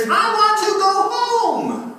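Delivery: high, loud, drawn-out wail; acted child's scream. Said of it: "and she screams".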